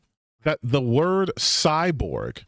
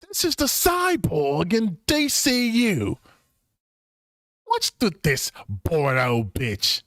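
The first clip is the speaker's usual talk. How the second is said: mock reading voice; 3 semitones higher, slower than usual. Reading a critic's post mockingly.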